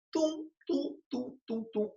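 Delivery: high-pitched "tum" beats, spaced apart; light, high "tum" for each cut; spoken chopping sound. Shown as a demonstration. Teacher mimics splitting a verse word by word, chopping sound.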